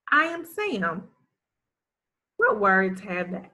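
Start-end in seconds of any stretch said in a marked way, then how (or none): 0.1-1.1 fluent read. Shown as a demonstration. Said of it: "Let's read this one".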